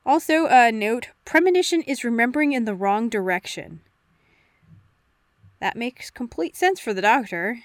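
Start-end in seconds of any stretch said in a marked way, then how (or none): none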